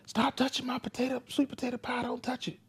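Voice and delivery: put-on very high, whiny childish sing-song; imitating daughter. Father mimicking daughter's act, very high whiny voice. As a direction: squeak it out like a bratty kid. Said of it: "this is the play that she does".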